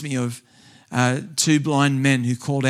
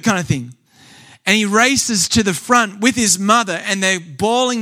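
rising, louder preacher build; fervent sermon storytelling, building; preaching climax; 3 semitones higher, 3 dB louder than usual. Acted. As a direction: drive the testimony up to its peak.

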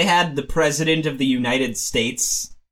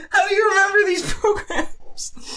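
laughing. Real, not acted. Laughing question.

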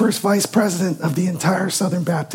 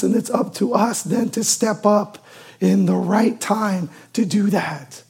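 usual speaking voice

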